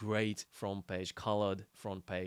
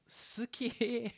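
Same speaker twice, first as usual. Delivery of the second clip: Suzuki-san; 14 semitones higher than usual. Retold or imitated. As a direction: call it out high, without real volume. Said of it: "Suzuki-san just shouts out".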